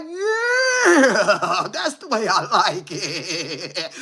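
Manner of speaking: hammed-up delighted taunt; laughing mock glee. Taunting the fleeing boyfriend, laughing with glee. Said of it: "I was like".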